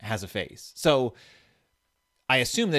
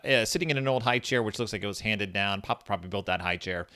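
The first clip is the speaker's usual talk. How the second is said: normal talking voice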